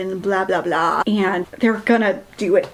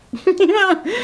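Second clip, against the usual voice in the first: laugh; 8 semitones higher than usual. Real, not acted. Laughing about the rating.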